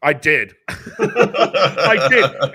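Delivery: laughing through the words. Real, not acted. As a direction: giggle while admitting it. Laughing admission.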